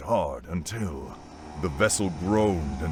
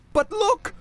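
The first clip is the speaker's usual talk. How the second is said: put-on very high, loud boyish protest; insistent, high-pitched deckhand; protesting young deckhand; 6 dB louder than usual. A narrator's reading. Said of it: "the young deckhand protested".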